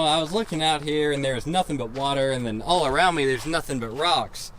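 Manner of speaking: ordinary talk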